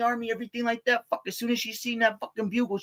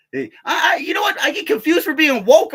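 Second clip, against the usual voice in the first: higher, loud shout; 9 semitones higher, 8 dB louder than usual. Real, not acted. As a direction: shout it out with a high voice. Loud outburst to listener.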